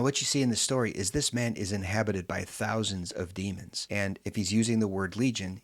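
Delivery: normal talking voice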